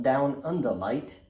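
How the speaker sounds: lightly put-on Australian accent; brief, jokey attempt at an Australian accent; terrible Australian accent. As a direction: have a poor go at an Aussie accent on "mate". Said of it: "terrible Australian accent".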